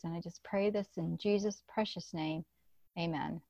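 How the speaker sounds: soft, earnest prayer voice; closing prayer. Real, not acted. Host closing the podcast in prayer to the Lord, soft and earnest. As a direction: pray quietly to close the show.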